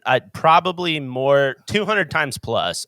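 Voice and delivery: normal talking voice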